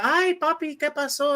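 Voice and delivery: high, sweet Dominican abuela voice; sing-song auntie at the door; old lady greeting. Retold or imitated. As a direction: greet the visitor like a doting grandma. Old ladies answering the door.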